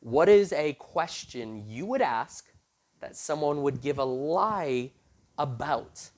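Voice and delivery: slow, deliberate re-asking of the question; slow patient repeat. Retold or imitated. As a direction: spell the question out slowly for the contestant. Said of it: "let me try this again".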